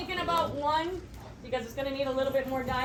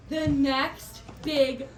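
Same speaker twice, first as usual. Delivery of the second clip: normal talking voice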